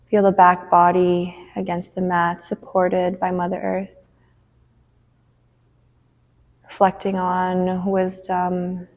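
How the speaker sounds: unhurried breath-by-breath guidance; guided relaxation. Acted. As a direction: guide them gently into rest, leaving space between phrases.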